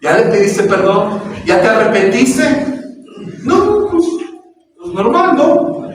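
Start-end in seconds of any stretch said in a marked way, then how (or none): 3.4-5.9 high-pitched, evasive little brush-off; high, feigned innocence; mock nonchalance. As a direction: play an unrepentant believer shrugging it off. Acting unrepentant believer making light of it.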